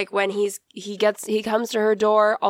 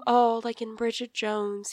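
ordinary delivery

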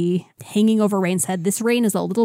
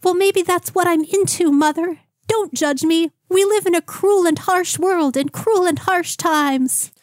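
higher-pitched mock-medieval lad; Doug the Peasant voice; 9 semitones higher than usual. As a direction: stay in the squeaky lovesick boy voice. Keeps doing high Doug voice.

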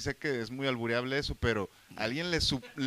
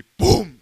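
loud, sudden boom; explosive mimicked thud; imitated slam; 6 semitones higher, 16 dB louder than usual. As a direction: punch out the bang of a door. Imitates the door slamming.

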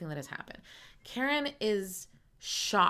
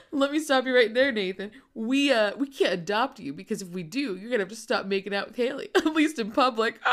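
Jimmy's voice; 2 semitones higher, 6 dB louder than usual. Retold or imitated. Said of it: "[name], is like".

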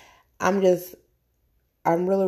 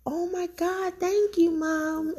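put-on much higher, delighted kid's voice; imitating her son; 13 semitones higher, slower than usual. Son waking to the party decorations, thrilled high voice. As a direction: pitch up like a thrilled little boy. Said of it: "My son woke up like".

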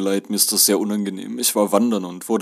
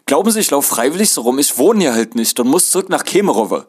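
raised, higher exasperated voice; loud desperate pleading; 5 semitones higher, 5 dB louder than usual. A narrator's reading. Muddy passenger begs conductor for a ride, pleading loudly. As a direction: plead your case loudly, at wit's end.

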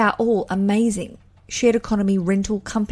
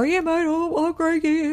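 high, hammed-up Australian mateship voice; very Australian bloke; 8 semitones higher than usual. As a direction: put on a high, broad Aussie mate voice. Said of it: "that was very Australian".

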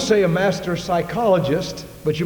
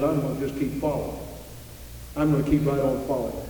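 softer, faster steady resolve; quiet resolve; 4 semitones lower, 8 dB quieter, faster than usual. Retold or imitated. Elisha answers the doubters with soft, steady resolve. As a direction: say it low and determined.

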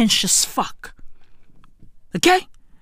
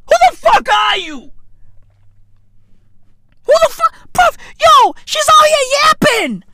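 much higher, louder incredulous yell; incredulous shout; 13 semitones higher, 3 dB louder than usual. Real, not acted. Mocking the heiress all over TV, incredulous high yell. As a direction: yell it in disbelief.

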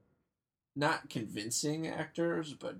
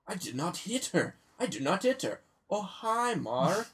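higher, odd vaguely foreign line read; odd-accented Tommy read, higher pitch; Tommy Wiseau delivery; 7 semitones higher, 4 dB louder than usual. Retold or imitated. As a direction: ham it up like Tommy, then a high bright hi. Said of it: "that doesn't sound that fun ... but with him".

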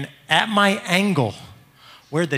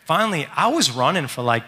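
ordinary delivery, nothing marked

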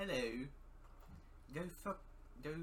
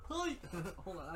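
usual speaking voice

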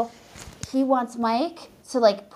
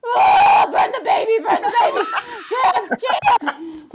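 much higher, louder panicked yelling; terrified shout; 11 semitones higher, 7 dB louder than usual. Retold or imitated. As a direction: scream it in mock terror. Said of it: "I was so scared of him".

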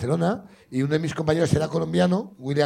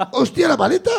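sudden realisation; 6 semitones higher, 4 dB louder than usual. Retold or imitated. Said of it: "Como que caigo".

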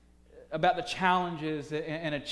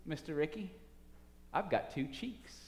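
soft, quiet, steady answer; calm; 9 dB quieter than usual. Acted. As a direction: let it land gently. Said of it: "Robinson calmly thinks".

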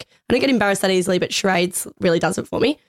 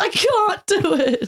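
a slow, pitched-up mock wail of giving up; retold despair; 11 semitones higher, much slower than usual. Friend recalls failing charades, mock despairing wail. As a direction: act out her helpless give-up, slow and high. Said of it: "laughing and then crying".

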